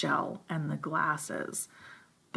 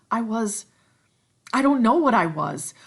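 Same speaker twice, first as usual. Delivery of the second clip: put-on higher, louder faltering boy; unsure Ralph; 6 semitones higher, 10 dB louder than usual. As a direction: grope for words. Ralph uncertain.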